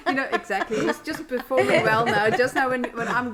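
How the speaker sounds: amused, chuckling through it; giggly joking voice; laughing. Real, not acted. Joking about baby performing for camera.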